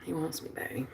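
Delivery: very soft, low murmur; hushed gloating. Real, not acted. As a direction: murmur it privately to yourself. Murmurs it to herself.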